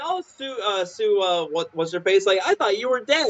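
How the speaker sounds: high cheery neighbour voice; chirpy, clueless greeting; high cartoon neighbour, fumbling the name; goofy neighbour. Neighbour voiced meeting zombie.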